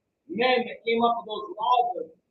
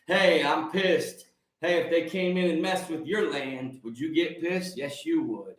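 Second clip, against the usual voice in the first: ordinary delivery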